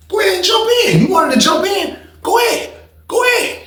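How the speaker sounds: hyped taunting shout; egging on. Retold or imitated. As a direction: yell at him to jump in. Egging homeboy to join the fight.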